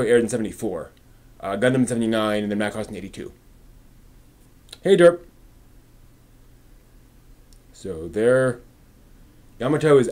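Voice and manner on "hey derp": loud greeting call. Real, not acted. Calling out a greeting to someone.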